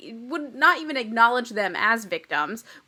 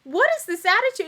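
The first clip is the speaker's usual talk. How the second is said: higher mock indignant; 7 semitones higher, 5 dB louder than usual. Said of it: "jumps down her throat and goes".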